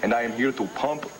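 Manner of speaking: hammed-up German analyst accent; bad German accent. Pupil of old Siggy analyzing dreams, mock German accent. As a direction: put on a silly, poor German accent. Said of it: "That's the worst German accent".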